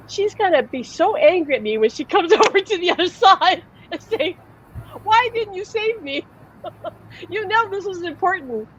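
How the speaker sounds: high, fretful voice of a worried spirit; pleading spirit. Retold or imitated. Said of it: "And he said, "You don't understand".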